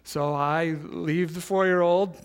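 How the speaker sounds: usual speaking voice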